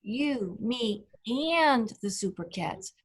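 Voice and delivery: "and" drawn out after separate "You" and "Me"; stress on AND. Shown as a demonstration. Teacher repeating the story title, stress on "and". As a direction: give "and" the big beat of the title. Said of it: "he emphasizes that word".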